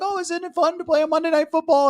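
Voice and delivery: high, fast mimicked question; mock reporter. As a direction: put on a high-pitched, gushing questioner.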